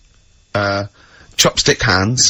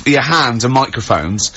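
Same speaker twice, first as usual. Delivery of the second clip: ordinary delivery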